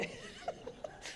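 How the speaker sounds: sudden high giggle, breaking up; high laugh. Real, not acted. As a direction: laugh at yourself, caught off guard. Laughs at herself mid-story.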